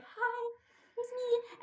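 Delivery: high, wobbly, nervous hello; nervous shaky greeting. Retold or imitated. To grocery clerk, imitating her old nervous hello. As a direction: squeak it out, scared to speak. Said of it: "my voice would shake".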